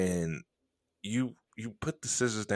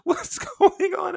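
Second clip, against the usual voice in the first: high, drawn-out laughing squeal; loud, high, slowed by laughter; imitated helpless laughing; 6 dB louder than usual. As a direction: squeal it through mock hysterics. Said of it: "you were laughing with me like".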